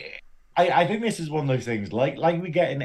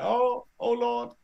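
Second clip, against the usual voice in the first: mock exasperation; 10 semitones higher, slower than usual. Mock despair.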